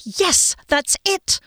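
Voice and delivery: high, eager outburst; excited confirmation. A narrator's reading. Friend finally understood, excited confirmation. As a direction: seize on it, pitch way up.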